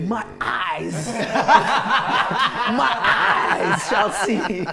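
prayer voice breaking into laughter; laughing prayer voice. Pretend. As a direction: pray it through giggles, drawn out. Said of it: "that preacher voice".

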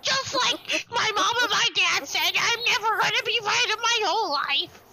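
whiny, high mock lament; mock self-pity.

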